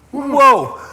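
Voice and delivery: loud, high whoa of alarm; stunned, high-pitched yelp; shocked whoa. Real, not acted. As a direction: burst out as the burn hits. Reacting to burning hot sauce.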